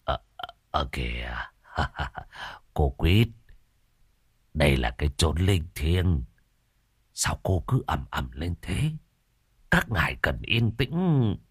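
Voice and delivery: low, soft hesitant pleading; nervous old man. Acted. Tam meekly begging Quýt to keep quiet, low and soft. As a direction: placate her meekly.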